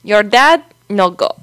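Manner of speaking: hammed-up Spanish-accented English; attempted Spanish accent. Shown as a demonstration. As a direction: imitate a dentist with a Spanish accent. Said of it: "like, a Spanish accent is".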